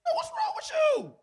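acted exasperated shout, very high and loud; high-pitched mock scolding of a child; frustrated parent outburst. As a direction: yell it like a parent losing patience. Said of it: "'cause I was frustrated by the decision".

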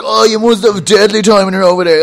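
put-on excited outsider voice; mock enthusiasm. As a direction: gush it loudly, mocking them. Mimicking people who envy his trip.

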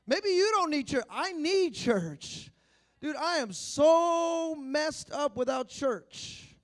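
loud slow preaching voice. Acted. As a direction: preach it out, drawn out. Preaching at full force.

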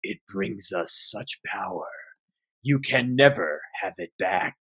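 deep echoing alien voice; a hint of a booming tentacled horror; giant alien booming voice. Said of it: "A deep voice echoes from the tunnels".

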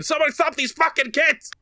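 high-pitched mock scream at the kids; retold scream. Chef screaming at rowdy birthday kids, hammed-up yell. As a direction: yell it in a shrill, frazzled pitch. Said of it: "just screaming".

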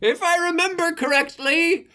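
put-on old man voice. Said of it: "old man Harry".

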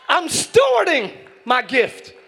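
each word spaced out slowly; slow, emphatic declaration. Acted. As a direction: drive the point home slowly. Preaching emphasis.

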